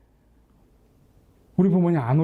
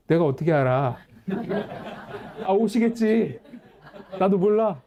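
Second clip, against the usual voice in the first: retelling a testy brush-off, half laughing; mock annoyed reply, a laugh breaking in; irritated snap; 4 semitones higher than usual. New father to his wife, mock-irritated brush-off. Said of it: "짜증을 내는 거예요".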